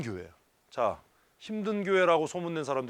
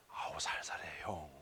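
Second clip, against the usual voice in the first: unvoiced, much softer mock plea; whispered plea; 12 semitones lower, 16 dB quieter than usual. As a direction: whisper it like a tired colleague. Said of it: "retold plea, whispered".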